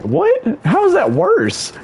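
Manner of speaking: very high, squeaky-pitched disbelief; retold incredulity. Told the morning will be worse, high incredulous reaction. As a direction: pitch it way up, baffled.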